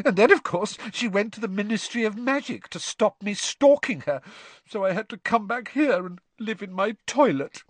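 put-on high, whiny ghost-girl voice; aggrieved ghost, pitched well up. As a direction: complain on in a high, self-pitying whine. Myrtle continues her grievance.

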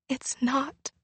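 soft, high, whispered lament; whispered grief. Acted. Girl in a family hug, whispering grief. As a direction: breathe it out through tears.

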